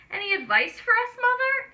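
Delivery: high, quick little piglet voice. A narrator's reading. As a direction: ask like an eager small piglet. Little pig voiced in story.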